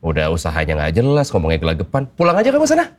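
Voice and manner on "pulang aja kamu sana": angry dismissal. Acted. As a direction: throw him out with a sudden high yell. Dad throws boyfriend out.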